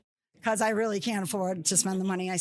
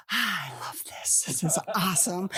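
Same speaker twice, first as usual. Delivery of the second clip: excited whisper. Retold or imitated. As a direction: breathe it with delight. Thrilled at screaming kids.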